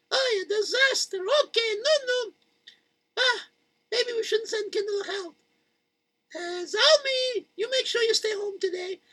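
high, whimpering mother voice; half-sob it, high and soft; crying mother. Acted. Mother keeping Zalmi home, high and tearful.

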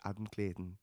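a quick bad Irish accent. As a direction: say the name in a hammy Irish voice. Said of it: "it's meant to be Irish".

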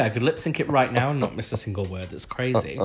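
usual speaking voice

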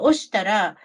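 ordinary talk

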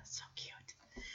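soft whispered so cute; whispering. Real, not acted. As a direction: whisper it tenderly. Whispering over the baby.

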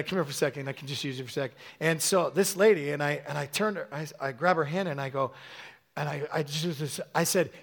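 ordinary talk

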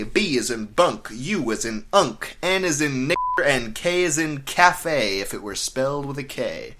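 normal talking voice

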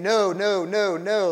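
mildly emphatic string of refusals. Retold or imitated. Preacher voicing Paul correcting false teachers, mildly emphatic. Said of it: "Paul comes in very emphatically saying".

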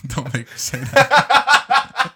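high, loud, slow playful whine; mock protest. Real, not acted. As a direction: beg them not to make you say it. Teased to say aluminum.

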